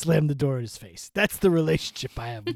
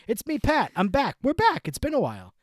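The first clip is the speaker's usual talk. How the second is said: higher, louder, punchy; excited announcement; 8 semitones higher, 4 dB louder than usual. Acted. Host announces their return, excited and high.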